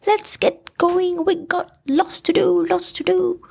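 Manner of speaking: high, delighted bear; Pooh agreeing. A narrator's reading. Pooh agreeing with Rabbit's plan, high and delighted. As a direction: burst out high and pleased.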